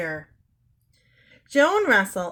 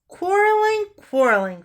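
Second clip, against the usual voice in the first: exasperated; 11 semitones higher, 6 dB louder than usual. A narrator's reading. Exasperated mother to herself.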